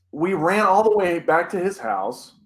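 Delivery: ordinary talk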